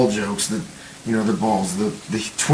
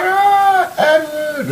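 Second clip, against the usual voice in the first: very high, drawn-out imitation scream; mock waking scream; 3 dB louder, much slower than usual. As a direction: wail the name high like a hammed-up nightmare. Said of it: "she's like, wakes up screaming".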